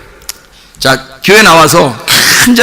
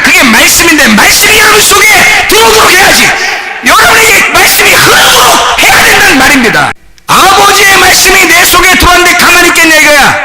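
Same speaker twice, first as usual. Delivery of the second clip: fiery preaching; 10 semitones higher than usual. Acted. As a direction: preach it out with full force. Preaching at full force.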